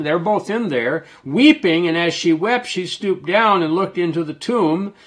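lifted preacher's reading tone; scripture reading. Acted. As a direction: read the verses out to the congregation. Reads scripture aloud.

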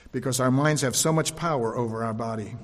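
usual speaking voice

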